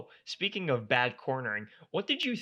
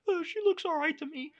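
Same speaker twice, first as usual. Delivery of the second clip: much higher put-on voice; mock officials; 3 dB louder than usual. Host mocking corner, ref and doctors, high mock voice. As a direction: parody the clueless officials. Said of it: "all three of them are like".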